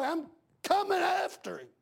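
high, ringing threat on coming; preaching cry. Real, not acted. Father vowing to go after the man who hurt his boy, high cry. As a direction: fling it out high and hard.

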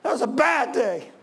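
emphatic, high preaching cry; impassioned repeat. Real, not acted. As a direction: drive it home to the congregation. Repeats the outcry after congregation responds.

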